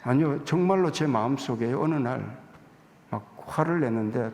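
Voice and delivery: ordinary talk, nothing marked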